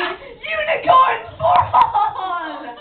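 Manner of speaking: long, high triumphant shout. Acted. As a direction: cry it out loud, stretching the words.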